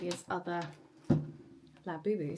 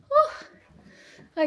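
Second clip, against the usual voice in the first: high, loud puff of relief; exhausted laugh-sigh; breathless whew; 9 dB louder than usual. Real, not acted. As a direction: blow out a winded whew. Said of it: "I'm out of breath".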